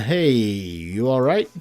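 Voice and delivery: slow, gentle dad check-in; concerned. Acted. Dad notices daughter upset.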